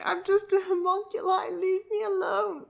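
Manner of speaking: high-pitched put-on whine; high voice, dragging out the last words; freaking-out character. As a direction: quote him in a high comic voice. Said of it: "He's like".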